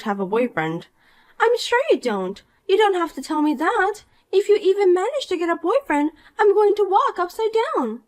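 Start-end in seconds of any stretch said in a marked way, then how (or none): 1.4-8.0 put-on bright, higher voice of a smug mean girl; snide rich girl. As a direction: talk down to her with sweet contempt. Poppy scoffs.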